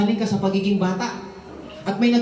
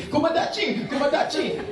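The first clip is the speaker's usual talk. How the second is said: higher mock Japanese baby cry, laughing; 7 semitones higher than usual. Pastor joking a Japanese baby cries in mock Japanese. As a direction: fake Japanese words wailed. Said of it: "yung Hapon na baby pag umiyak".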